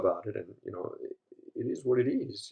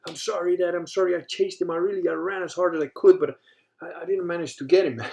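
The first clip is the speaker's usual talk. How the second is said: high, louder, strained sorry to dad; imitating crying apology; 9 semitones higher, 6 dB louder than usual. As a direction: replay the tearful ten-year-old pleading, pitched up. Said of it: "I was crying from".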